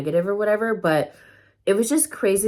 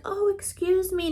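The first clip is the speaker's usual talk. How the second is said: so nice; 13 semitones higher than usual. Retold or imitated. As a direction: imitate a friend excusing herself sweetly. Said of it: "she asked so nice".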